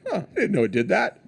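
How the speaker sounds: high, laughing mock surprise; hammed-up surprised presenter; mock surprised exec. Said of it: "He's like".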